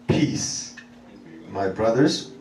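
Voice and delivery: low, soft blessing of peace; gentle greeting. Acted. Risen Jesus to his disciples, gently. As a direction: speak peace softly and low, unhurried.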